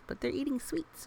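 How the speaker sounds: ordinary delivery, nothing marked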